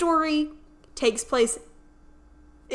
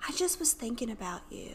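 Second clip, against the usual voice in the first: whispering, much softer; whispered retelling; 9 dB quieter than usual. Retold or imitated. Retelling the advisor's quarantine call, in a whisper. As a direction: hush it like a tender confession.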